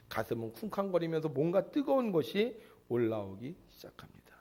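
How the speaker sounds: hushed breathy telling of rising emotion; whisper. Real, not acted. Preacher describing disciples' pounding hearts, whispered. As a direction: whisper it like a secret thrill. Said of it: "whispered narration".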